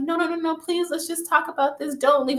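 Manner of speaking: high-pitched mock begging; retold girlfriend's anxious, high plea; imitating desperate pleading. Girlfriend begging boyfriend not to leave.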